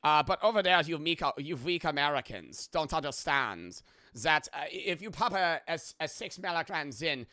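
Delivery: boastful cartoon German accent. Shown as a demonstration. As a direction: condescend in a hammed-up German accent. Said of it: "Do the German accent".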